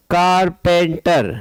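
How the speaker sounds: "Carpenter" stretched into three beats, car-pen-ter; slow syllable-by-syllable. Shown as a demonstration. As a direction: draw out each syllable of "Carpenter". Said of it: "when you are pronouncing this word".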